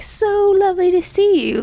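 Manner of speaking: high, gushing mock greeting; smooth old flame. Chris runs into his old lover, mock-gushing and high. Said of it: "And he's like".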